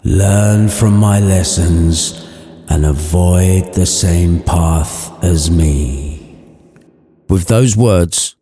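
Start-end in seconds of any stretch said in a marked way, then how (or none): 0.0-6.2 spooky ghost voice. Acted. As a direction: drag every word out like a chained ghost. Marley's ghost retreats.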